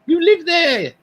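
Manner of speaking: high-pitched, pointed retort; bantering, exasperated comeback; insistent retort. Real, not acted. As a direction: press the point, pitch jumping up.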